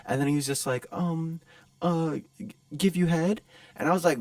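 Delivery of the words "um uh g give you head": high, hesitant mock question; nervous higher-pitched impersonation; timid stranger. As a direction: stammer up to an embarrassing offer. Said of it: "and, like, timid".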